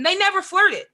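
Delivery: NEVER hit high and hard in "They never flirted"; emphatic denial. Real, not acted. Insisting to his co-host that they never flirted, with heavy stress on "never". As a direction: lean hard on "never", then let it fall.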